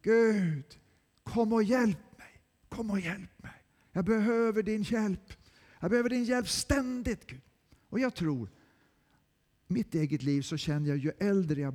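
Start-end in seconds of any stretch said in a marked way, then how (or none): 0.0-7.4 raised pleading call to God; earnest raised plea; pleading cry. Acted. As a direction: beg God for help. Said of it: "behov att ropa".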